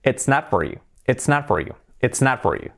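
"It's not for you" with a crisp TS in "It's", rest linked; contracted "It's", T clearly sounded; marked T. Shown as a demonstration. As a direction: hit the TS of "It's", run the rest together. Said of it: "la T sí que se marca".

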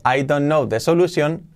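"I don't know the solution" with each word kept apart; full "don't know", nothing linked; textbook English. Shown as a demonstration. Teacher voices what learners have assimilated, textbook "I don't know the solution". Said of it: "que si tienes asimilado".

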